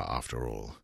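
ordinary delivery

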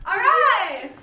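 high, loud "all right!"; excited cheer. Real, not acted. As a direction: burst out with a big cheer. Improv warm-up cheer.

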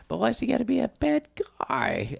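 lightly voiced cartoon quote, "bad" pitched high; quoting Wreck-It Ralph character. Host jokingly quoting an animated movie line, light character impression. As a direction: stretch out a mock movie catchphrase. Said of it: "as Wreck-It Ralph says".

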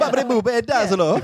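high, hammed-up Italian accent; bad Italian accent. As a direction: sing out a cartoon Italian accent. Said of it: "the bad Italian voice".

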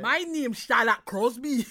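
slow, badly faked Geordie; mock Geordie that drifts Scottish; Geordie accent. Host introducing self as the reality star, badly faked Geordie accent. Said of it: "No, that's Scottish".

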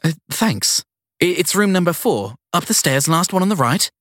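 put-on flustered young woman. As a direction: caught off guard and eager to please. Sadie talks to chauffeur.

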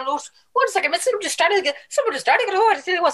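high-pitched mock alarm; imitated soap viewer. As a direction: squeal it like a gripped telly watcher. Acting out someone glued to the soap.

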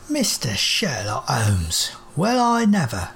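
a lounging machine amazed at the visitor; cockney robot. Acted. Robot with feet on table.

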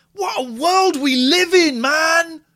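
loud, high, slow exasperated cry; exasperated exclaim. Real, not acted. Exclaiming to a friend about the council's absurd refusal, loud and high. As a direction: throw up your hands at the absurdity.

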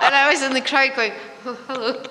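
high, loud, laughing as you tell it; helpless giggles through the words; telling it while cracking up. Real, not acted. Cracking up at own anecdote.

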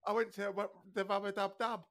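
playful fake Cockney; mock Cockney. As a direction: put on a jokey London accent. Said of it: "your little wet rot country has, like, seventy-five accents".

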